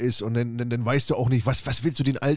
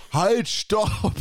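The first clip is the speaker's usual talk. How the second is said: badly acted; 8 semitones higher than usual. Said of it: "Der macht das total schlecht".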